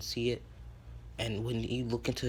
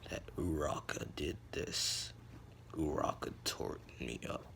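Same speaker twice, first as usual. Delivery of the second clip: dark, hushed low register; deeper voice; 8 semitones lower, 6 dB quieter than usual. Acted. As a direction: drop deep and brood over each word. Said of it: "his voice got deeper".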